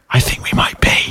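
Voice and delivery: hushed, voiceless whisper. Shown as a demonstration. Co-host admits they might be the problem, whispered. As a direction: whisper it like a guilty confession. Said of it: "I'll whisper it quietly".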